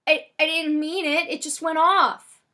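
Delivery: loud, high, slow protest; panicked yelling back. A narrator's reading. Stormy yells back to Frosty after the gun went off, loud high protest. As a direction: yell it in stammering self-defence. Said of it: "Stormy yells back to Frosty".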